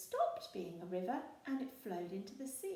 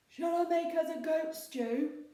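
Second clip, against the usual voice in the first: loud, higher hopeful troll; 9 semitones higher, 10 dB louder than usual. A narrator's reading. Troll offering pirates goat stew, hopeful. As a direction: offer his favourite dish. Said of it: "he asked".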